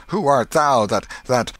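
high, loud, stumbling declamation; declaim, then lose the line; stagey fake archaic declaiming. A narrator's reading. Joe forgets his line.

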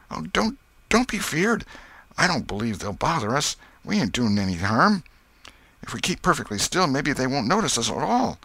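put-on high, earnest boy's voice; anxious comfort for a scared pal; Tom, nervously reassuring boy. A narrator's reading. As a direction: keep your friend calm, high-pitched.

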